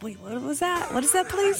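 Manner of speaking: very high, mock-confused voice; high, hammed-up puzzled questions; acted bafflement. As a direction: play up not understanding, high-pitched. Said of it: "every time you say that".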